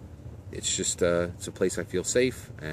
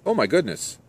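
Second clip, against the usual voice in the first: mildly startled, pitched-up exclamation; higher, gently acted shock; 8 semitones higher, 4 dB louder than usual. A narrator's reading. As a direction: voice her jumping back in surprise. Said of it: "Sarah backs up startled".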